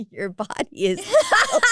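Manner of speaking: giggling through the words; can't get it out for laughing; high, slow, broken up by laughter. Real, not acted. Laughing through masseuse's quote.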